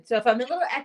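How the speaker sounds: echoing room sound; echoey bathroom. Real, not acted. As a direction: voice bouncing off bathroom tiles. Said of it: "I'm in the bathroom".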